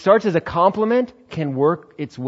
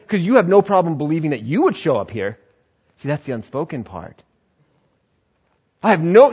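ordinary talk

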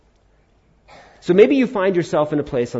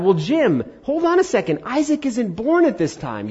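high-pitched voice of an objector; imagined objector; 9 semitones higher than usual. Retold or imitated. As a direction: raise an objection in a much higher voice. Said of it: "you think about it".

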